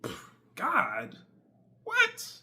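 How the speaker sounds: high, scoffing dismissive voice; mocking the world. World scoffing at him, high mocking disbelief. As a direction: scoff at the idea of God like the crowd does. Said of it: "the world is telling me".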